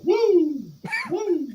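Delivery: high drawn-out whoops; Ric Flair. Acted. Echoes the wrestler's whoop.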